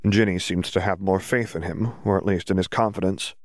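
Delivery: ordinary delivery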